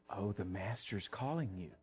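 very soft, low, reverent-sounding call; hushed summons. Acted. Crowd walking over to the beggar, hushed and coaxing. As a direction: drop to a tiny, low voice.

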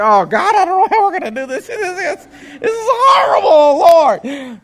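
high, loud anguished wail to God; despairing cry. Retold or imitated. Alone in his truck crying out to God, anguished and loud. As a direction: break down crying out to the Lord. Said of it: "fall down and say".

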